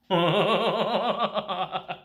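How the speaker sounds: long, rolling laugh; real sustained chuckling; amused chuckle. Real, not acted. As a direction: laugh knowingly at the story. Amused by the elf's dress.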